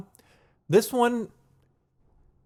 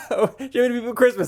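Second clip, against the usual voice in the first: laughing catchphrase; 9 semitones higher, faster than usual. Real, not acted.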